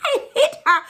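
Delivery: high, loud laughing confession; real giggly, high-pitched telling. Real, not acted. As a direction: blurt it out through laughter. Laughing while telling the story.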